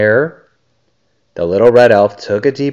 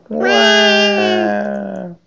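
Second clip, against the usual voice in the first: high, long pretend baby wail; mock crying wail; 12 semitones higher than usual. A narrator's reading. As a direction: wail a drawn-out fake cry. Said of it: "Then they began to cry".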